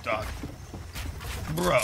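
ordinary talk, nothing marked

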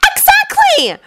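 high, ringing cry of triumph; triumphant shout. Acted. Hermione scoring off Ron, triumphant high shout. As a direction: crow it like she's just won the point. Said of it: "Hermione shouted in triumph".

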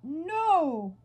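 high, loud mock cry of "No"; mimicked scream. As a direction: imitate the toad screaming no. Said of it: "Did he just scream".

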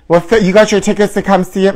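ordinary talk

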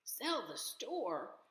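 can't believe his ears; disbelieving. A narrator's reading. Mister Whiskers repeating Seth's news, slow and astonished.

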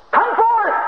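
high ringing shout of come forth; screamed command. Acted. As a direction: call it out like God commanding life. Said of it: "He screamed".

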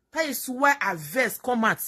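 ordinary delivery

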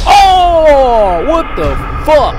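loud, high startled yell; sudden loud disbelief; shocked outburst. Real, not acted. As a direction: blurt it out in real shock. Getting robbed in game, shocked.

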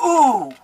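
high, loud "ooh"; big falling squeal of delight. Real, not acted. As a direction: burst out an admiring ooh. Delighted reaction to the cards.

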